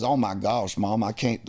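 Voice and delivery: imitated Southern kid's drawl; thick Southern accent. Himself as a kid talking to Mom, thick Southern accent. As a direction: play your younger self with a Southern accent. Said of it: "I had a very thick".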